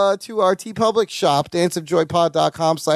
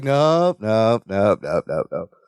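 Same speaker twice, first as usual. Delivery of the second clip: repeated nopes dropping steadily much lower; sinking denial; 8 semitones lower than usual. Retold or imitated. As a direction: start near normal, sink into a deep, fading mumble. Acted denial, sinking lower each time.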